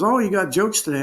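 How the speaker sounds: a light imitation of the nurse teasing. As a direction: tease him like the nurse did. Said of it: "and he goes".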